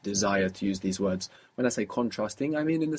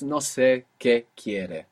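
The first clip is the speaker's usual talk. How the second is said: slow, careful Spanish; slow model answer; slower than usual. Shown as a demonstration. Teacher modelling the Spanish answer slowly. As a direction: say it slowly so it sinks in. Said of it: "I don't know what he wants".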